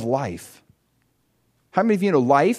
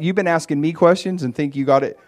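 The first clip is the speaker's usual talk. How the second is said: ordinary talk, nothing marked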